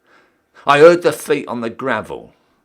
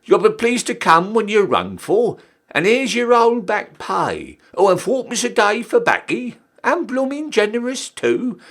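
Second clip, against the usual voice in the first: mock Queen's voice; 8 semitones higher than usual. Recruit mimics Her Majesty dismissing him, high and prim. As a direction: play the Queen, grandly generous. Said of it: "Her Majesty says".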